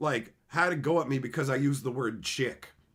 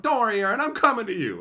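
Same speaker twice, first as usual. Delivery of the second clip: higher, louder defiant call; 7 semitones higher, 7 dB louder, faster than usual. Retold or imitated. Defying the manager to reach Aaron, loud call. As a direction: shout reassurance across the room.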